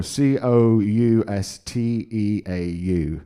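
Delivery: slow, letter by letter; spelling it out. Shown as a demonstration. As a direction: spell each letter slowly and clearly. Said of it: "How do you spell that".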